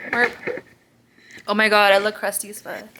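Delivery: ordinary delivery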